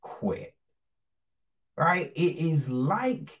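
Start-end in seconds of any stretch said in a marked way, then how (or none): 0.0-0.5 whispered dismissal. Real, not acted. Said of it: "you know what?".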